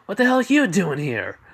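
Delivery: high, loud, incredulous question; startled demand. Acted. Izuku startled to see his aunt.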